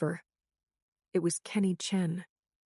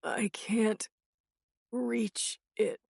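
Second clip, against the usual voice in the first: faint, higher strained voice; weak voice; 4 semitones higher, much slower than usual. A narrator's reading. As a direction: barely get the words out. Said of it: "a weak voice".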